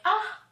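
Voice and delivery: high frustrated "ah"; exasperated groan. Retold or imitated. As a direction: groan of defeat at the list. Frustrated at unfinished list.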